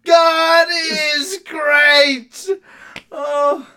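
high, drawn-out, crying with laughter; after laughing hard. Real, not acted. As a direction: wail it slowly through laughing tears. Helpless laughter reading line.

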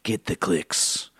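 low, slow, quiet villain whisper; evil voice. Acted. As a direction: murmur it like a scheming villain. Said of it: "my evil voice".

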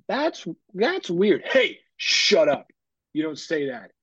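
slow, drawn-out scolding; stern reprimand. Acted. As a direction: shut it down like a parent correcting a kid. Acts parent reprimanding a child.

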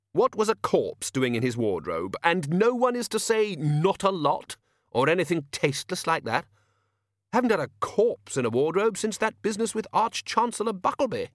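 put-on high, emphatic old wizard; indignant head wizard laying down rules; blustering Ridcully.

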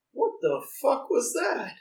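imitating high, drawn-out disbelief; slow, high-pitched mock bewilderment; baffled. Imitating office guy after a surprise hug, baffled.

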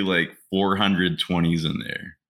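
usual speaking voice

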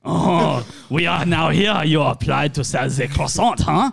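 put-on French interviewer; French accent.

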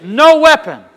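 forceful faith confession for a congregation to follow; preaching declaration. Real, not acted. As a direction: declare it boldly phrase by phrase. Leads congregation in bold declaration.

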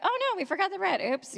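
high singsong "oops" reaction; lightly imitated little uh-oh; mock dismay. Imagines the disciples' casual reaction.

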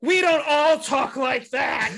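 high and slow, prissy protest; mock female voice. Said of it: "That's how females talk".

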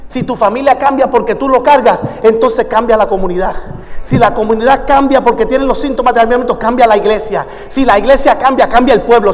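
usual speaking voice

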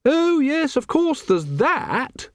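very high, drawn-out lady's voice; high character voice, slowing at the end; Lady Sybil. A narrator's reading. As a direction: play a grand lady, pitched right up.

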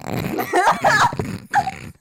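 long loud cackle; loud high laugh. Real, not acted. Laughing at own joke.

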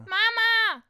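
put-on high loud child's call; shrill call up the stairs; child calling mama. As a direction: yell for mommy like a small kid.

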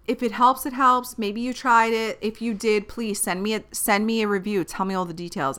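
ordinary talk, nothing marked

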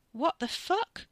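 high, stunned disbelief; shocked. A narrator's reading. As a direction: blurt it out in shock. Katie shocked by brother's act.

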